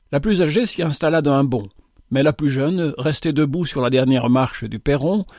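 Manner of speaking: ordinary delivery, nothing marked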